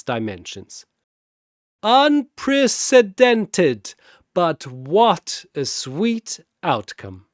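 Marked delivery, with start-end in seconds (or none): 1.8-7.3 high, loud and slow on Unprecedented; delighted host. Acted. Zurubang relishing Popo's surrender, high and slow. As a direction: savour the surprise.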